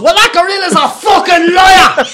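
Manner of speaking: high, loud, drawn-out outrage; hammed-up shout of a wronged man; mock furious shout. Comedian voicing man accused by a gorilla, furious shouting. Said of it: "he was furious because he said".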